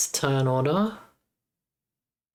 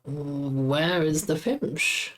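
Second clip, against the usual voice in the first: slow puzzled drawl; much slower than usual. Real, not acted. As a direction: stretch out "Where is the Fimsh?" slowly, every word drawn long. Repeats question, drawn out.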